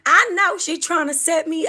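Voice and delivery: very high, country voice; a high twangy try that gives up. Retold or imitated. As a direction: squeak it like a country bumpkin. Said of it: "It's too high pitched".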